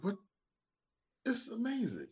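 high, astonished what; amazed. Retold or imitated. Recalling Okinawa in amazement. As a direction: gasp of wonder at the place. Amazed quote of own reaction.

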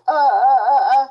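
meniru gagap tersendat, sedikit tinggi dan keras; mock stammering sob. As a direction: tirukan dia tergagap seperti mau menangis. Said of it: "the way she talks".

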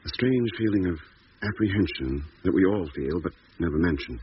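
measured, unhurried diary reading; diary narration. Acted. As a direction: read the log entry calmly over the music. Diary entry voiceover.

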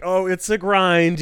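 slow, drawn-out mock weary jab; a knowing jab to a customer; little jab. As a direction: sigh it out as a knowing dig. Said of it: "that little jab".

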